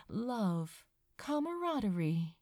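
ordinary delivery, nothing marked

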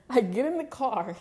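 can't stop laughing at the memory. Real, not acted. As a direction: laugh while you say it. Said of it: "It's not even funny".

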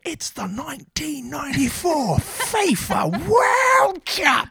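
high, booming, drawn-out announcer shout; crazy announcer. Retold or imitated. Imitating the World Cup pre-show announcer, crazy announcer voice. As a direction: holler it like an over-the-top World Cup announcer. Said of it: "crazy announcer voice".

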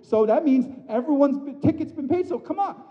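high, loud mocking a lax view; mock the idea that anyone just walks in; mock careless view. Pastor mocking a false teaching, high and loud. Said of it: "what we're not saying is".

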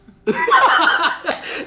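high, loud burst of laughter; real high-pitched cackling laugh; real laughing fit. Real, not acted. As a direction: crack up at your own story. Cracking up at own story.

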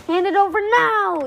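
high, loud, demanding crab monster; monster's yell. A narrator's reading. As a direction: yell it as a threatening order. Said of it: "yelled the crab".

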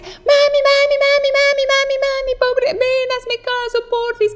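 put-on high begging child voice, mami repeated; whiny pleading child. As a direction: plead like a little kid tugging at mom. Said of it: "No te va a decir". (niño suplicante con voz muy aguda)

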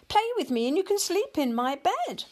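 high, pleading little voice; eager kitten. A narrator's reading. Tabby kitten offering its bed for a game, eager. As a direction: wheedle like a small kitten, pitched high.

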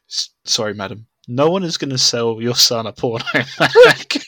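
polite apology breaking into a laugh; a laugh slips in mid-sentence. Retold or imitated. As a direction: say sorry politely, laughing at the end. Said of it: "wrongly, rightly said".

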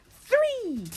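very high, drawn-out count; playful countdown peak. Pretend. Counting kids into pretend throw, excited high.

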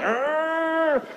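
long, strained grunt; drawn-out imitated grunt; straining grunt.

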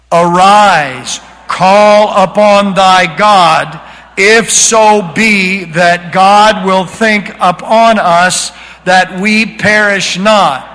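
slow, loud, drawn out command; slow booming scripture read; solemn scripture reading. Shown as a demonstration. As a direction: proclaim each word slowly like a summons. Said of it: "let's read the command".

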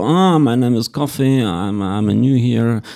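put-on slow English with Russian accent; lightly voiced shy boy, Russian-accented English. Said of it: "он такой был, такой, типа".

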